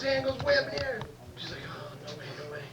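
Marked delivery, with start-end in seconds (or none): 1.7-2.6 low-volume aside to oneself; soft, acted reluctant mutter; muttered dread. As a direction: mutter it softly, avoiding the boy. Teacher avoids calling Billy, thinking aloud.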